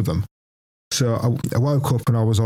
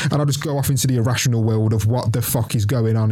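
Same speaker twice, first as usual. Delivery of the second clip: ordinary delivery, nothing marked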